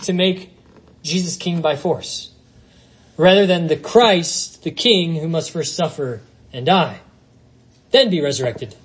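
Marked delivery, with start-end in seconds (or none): none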